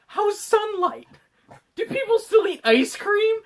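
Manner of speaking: very high, slow crazed wonder; drawn-out squeaky loony questions; hammed-up batty shut-in voice; Brett Spiner. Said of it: "the fucking craziest fuck you'll ever meet".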